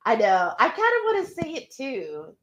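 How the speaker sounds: ordinary talk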